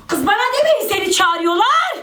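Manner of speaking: "demeyin" pushed up high, whiny and insistent; exasperated whine. Real, not acted. Telling her friends to stop relaying invites, high exasperated whine. Repeats request more insistently.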